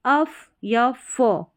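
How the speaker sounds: very slow, drawn-out अफ; sound demo. Shown as a demonstration. Teacher slowly models the अफ sound. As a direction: stretch अफ so students hear the f. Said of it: "इनफ जैसा sound".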